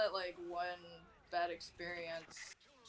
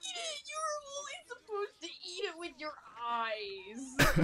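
mock scolding; 8 semitones higher, 3 dB louder, slower than usual. Teasing, voicing a mock scolding.

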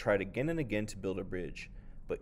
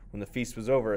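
ordinary delivery

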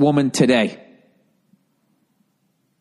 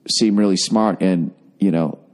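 normal talking voice

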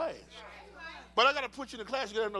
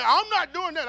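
retold refusal; 8 semitones higher, 11 dB louder than usual. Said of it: "she said".

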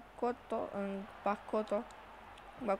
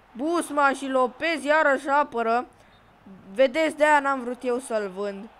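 excited commentary; 3 semitones higher, 9 dB louder than usual. Real, not acted. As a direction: shout it out proud of your keeper. Keeper saves, proud shout. (comentariu entuziasmat, mai tare)

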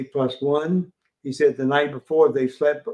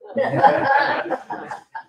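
hearty laugh; 13 semitones higher than usual. Real, not acted. Tour guide laughs with the crowd at his own joke.

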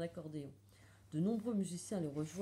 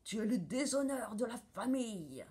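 storming; 2 semitones higher than usual. A narrator's reading. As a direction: thunder at a disgraced daughter. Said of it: "tempête le juge". (tempête de colère indignée)

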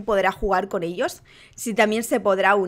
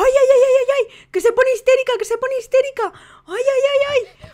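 high mock wailing cries; baby tantrum; 13 semitones higher, 4 dB louder than usual. Player voices the hysterical baby, high mock wail.

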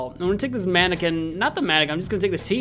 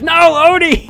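alarmed shout; 11 semitones higher, 12 dB louder than usual. Real, not acted. Dog hit by steam.

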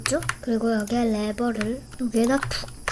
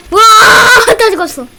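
anguished shout; 9 semitones higher, 15 dB louder, faster than usual. Real, not acted. Everyone dies in the game.

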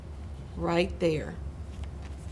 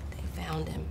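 very soft, nearly whispered line; hushed reveal; 3 semitones lower, 14 dB quieter than usual. A narrator's reading. Narrator to listeners after guess what, hushed. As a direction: drop right down as if telling a secret.

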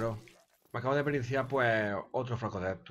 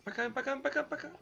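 high coaxing call; much faster than usual. Real, not acted. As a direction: call it over, pitched way up. Calling a creature over.

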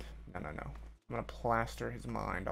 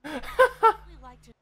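high, loud burst of helpless laughter; 10 dB louder than usual. Real, not acted. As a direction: crack up loudly at something absurd. Cracks up at glitched neck.

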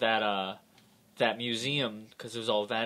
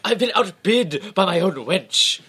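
pompous villain voice; 7 semitones higher, 10 dB louder, slower than usual. Acted. Voicing the outbid rich villain.

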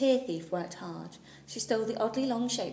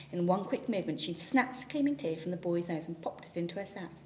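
plain reading voice

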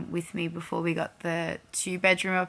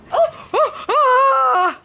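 startled; 7 dB louder, much slower than usual. Real, not acted. Startled by dog at window.

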